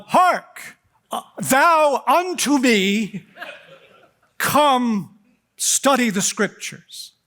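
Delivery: mock old-fashioned holy man, slow and high; mock archaic preacher. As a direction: ham up a pompous holy pronouncement, drawn out. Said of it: "he doesn't say".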